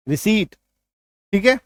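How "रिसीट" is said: "रिसीट" with no p sound; silent p. Shown as a demonstration. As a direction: model "रिसीट" with a long ee before t. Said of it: "यहां पे प नहीं रहेगा". ("रिसीट", बिना प के)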